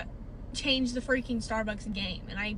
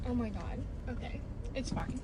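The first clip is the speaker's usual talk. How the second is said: normal talking voice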